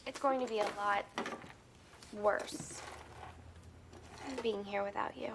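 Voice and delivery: low, halting, fading to a whisper; hushed, reluctant farewell; sad parting. Acted. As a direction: confide it with pauses, voice dropping away. Sad parting from her teacher.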